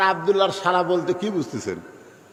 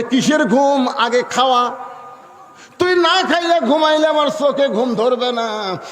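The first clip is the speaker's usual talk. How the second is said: higher and louder, insistent; eager fussing sister; 7 semitones higher, 4 dB louder than usual. Acted. Sister insisting her brother eat first, eager and louder. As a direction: fuss over him, no sleep before food.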